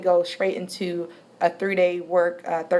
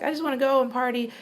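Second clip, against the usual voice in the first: quick, higher-pitched retold carefree line; naive younger self; 6 semitones higher, faster than usual. Student with no plans after high school, lightly naive. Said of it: "I was really naive".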